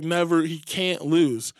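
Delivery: normal talking voice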